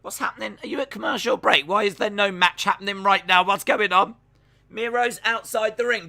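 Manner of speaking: baffled at the dead air; real disbelief at the broadcast; exasperated. Real, not acted. No match on screen.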